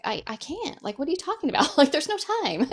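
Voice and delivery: high, laughing disbelief; mock-indignant "what are you talking about"; incredulous protest. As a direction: act out a flustered refusal. Retelling reaction to God's call.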